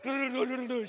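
quick apology called in passing. Retold or imitated. Skier to lady whose skis he cut across, called-out apology. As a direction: call sorry as you careen past.

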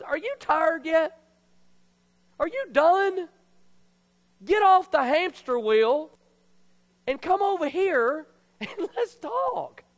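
high, loud preacher voicing God; God calling out. God to a worn-out believer, loudly coaxing. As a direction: call them over with slow, drawn-out emphasis.

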